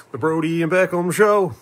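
playful title-card voice; mock show announcer. Repeats show name like an announcer.

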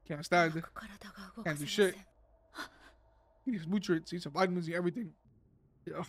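low, soft grumbling; sullen muttering. Real, not acted. Viewer still stewing over Mess, sullen muttering. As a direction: mutter under your breath, still annoyed.

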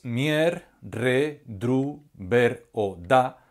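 "mier", "re", "dru", "ver", "da" said slowly, one at a time; stressed syllables alone. Shown as a demonstration. Teacher isolating stressed syllables for the class, slow. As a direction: set each stressed syllable apart, slowly. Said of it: "se pronuncian en estos casos con mayor intensidad".

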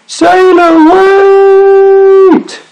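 very high, loud, long-held wail; cried out. A narrator's reading. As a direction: shout desperately over the wind. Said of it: "he cried".

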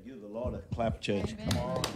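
ordinary talk

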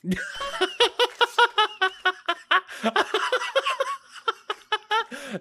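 long, high burst of laughter; delighted laughter. Real, not acted. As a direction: laugh out loud in real delight. Sees the monsters dancing.

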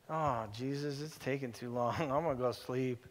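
soft, low, drowsy mock complaint; sleepy mock whine. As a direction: yawn it out like a tired disciple. Disciples voiced whining sleepily.

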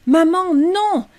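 put-on high, slow, stretched child's wail; drawn-out scream. A narrator's reading. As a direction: scream it long and high in horror. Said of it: "hurla Rachel".

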